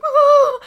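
short, high, loud imitated shriek; a kid's startled shriek, played for laughs; mock kid scream. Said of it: "We're like".

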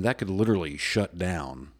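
ordinary talk, nothing marked

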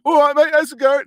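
loud, high-pitched caricature British mate; mock British street accent. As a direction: shout it as a cheery British lad. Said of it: "there's no people going".

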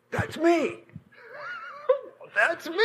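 high-pitched stunned realization, breaking up on the repeat; astonished recognition. Retold or imitated. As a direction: squeak it out in overwhelmed disbelief. Astonished at seeing his own body.

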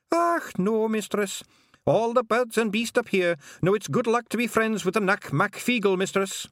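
cheeky wee Scots pixie; Scottish pixie. A narrator's reading. Feegle pixie voiced in story.

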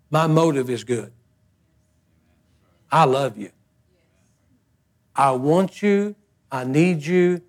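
low, soft heartfelt words; sincere. Real, not acted. Pastor assuring the congregation of his love, soft. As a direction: speak it from the heart. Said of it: "from the bottom of my heart".

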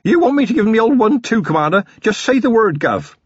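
put-on high, fast, keen young copper; itching to swing his club, raring to go; eager young constable. A narrator's reading. Eager constable offers violence.

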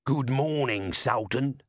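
cheerful street trader greeting. Acted. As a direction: greet them cheerily, selling already. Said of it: "said a cheerful and somehow familiar voice".

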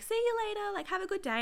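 a breezy front-desk farewell, lightly voiced; chirpy receptionist. Receptionist cheerfully sending a patient off, high chirpy goodbye.

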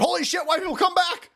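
high, frantic mock begging; mock desperate high plea; hammed-up pleading. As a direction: beg the farmers back in panic. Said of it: "And they said".

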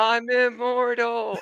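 imitated triumphant yell, high and drawn out; Rodney. Host recalls a teammate's yell on the video, imitated high victory cry. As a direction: mimic a victory cry, stretching it slowly up high. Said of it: "you hear Rodney yell".

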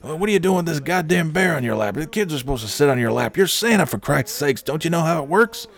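high, fast, exasperated boss rant; Cookie voice. Retold or imitated. Boss berating Lenny about the bear, high fast rant. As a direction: rattle it off like a fed-up boss. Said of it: "He's like".

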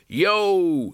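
a high shouted yo; triumphant battle cry of yo. Acted. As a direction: fling the word out like a spell. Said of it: "shouted the Dean".

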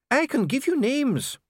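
a cornered dwarf pleading his case; anxious dwarf Dee. Acted. Dee defends himself before the king, high and pleading.

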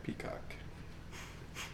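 soft unvoiced whisper. Shown as a demonstration. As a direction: breathe the word out quietly. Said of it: "break down a lot of words".

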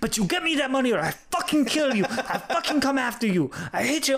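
high, fast mock raging; crazed threats. Swindler demanding money by voicemail, mock crazed rage. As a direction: flip suddenly into an imitated unhinged threat. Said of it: "going crazy".

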